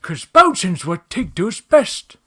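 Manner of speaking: loud, slow character impression; Tigger. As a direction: draw it out in a big high cartoon voice. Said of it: "that was my Tigger".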